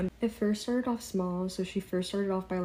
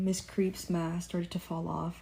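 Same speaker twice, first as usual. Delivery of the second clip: ordinary delivery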